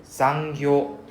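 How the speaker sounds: zangyo with the O held long. Shown as a demonstration. Teacher modelling zangyo with a dragged O. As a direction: drag the O in zangyo. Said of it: "we drag out the O sound".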